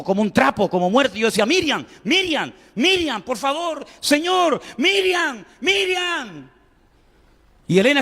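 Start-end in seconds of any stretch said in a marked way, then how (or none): 1.4-6.5 put-on a terrified father begging his child to wake; desperate calling. Father cries out to his unconscious daughter, high and desperate.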